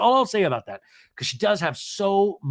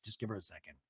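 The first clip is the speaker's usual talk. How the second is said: very soft, lower aside; hushed urging; 8 semitones lower, 18 dB quieter, faster than usual. Retold or imitated. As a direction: imitate calming everyone down. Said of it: "Heather says".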